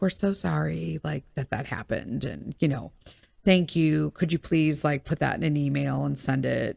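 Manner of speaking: imitating a timid manager who brushes it off; mock apologetic manager.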